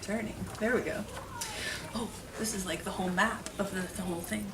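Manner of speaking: soft, fast mumbled aside; quiet aside. Real, not acted. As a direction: mutter it to yourself. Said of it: "aside showing pictures".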